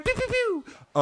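very high playful laser noise; mock laser effect; pew pew sound. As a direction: zap like a toy gun.

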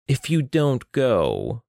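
slow stretched-out drawl. Acted. Solatravis warns his sister in a slow drawl. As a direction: draw each word out slowly. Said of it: "Solatravis drawled".

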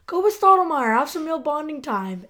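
high-pitched, eager urging; encouraging woman. Acted. Natalie urging Monk to go, high encouraging voice. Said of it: "she's like".

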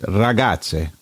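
ragazze slowly in Italian, rolled r and ts sound; ra-GAT-tse said slow and careful. Shown as a demonstration. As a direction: spell out ragazze like a native. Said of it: "is Italian for children".

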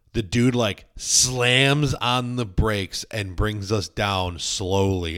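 ordinary talk

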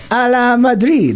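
mock Spanish flourish; hammed-up Spanish Madrid; Spanish accent. Mocking the Spanish club.

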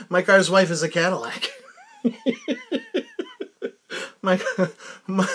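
giggling over the slip-up; cracking up at his own mix-up; laughing. Real, not acted. Mixes up words, laughing.

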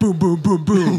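loud boom sounds. Pretend. Mock fight noises.

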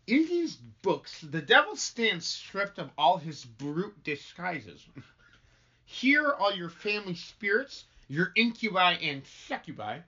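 slow, deliberate stage delivery; measured pronouncements with lifted phrase openings; solemn minister. Acted. Minister shows his books to the household, solemn. Script read in character, chat between.

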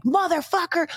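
high mock yell of a gamer kid; retold yelling. Mom retelling a boy swearing at his game, high mock yell. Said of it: "like, yelling and screaming".

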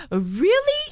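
high-pitched disbelieving question; retold incredulity. Fellow passenger questions the seat stealer, incredulous. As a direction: imitate someone who can't believe this.

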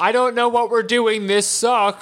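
high-pitched, mock exasperated gripe; Bulma complaining. Bulma griping from the back, high complaint. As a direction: voice a fed-up woman griping. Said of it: "she's like".

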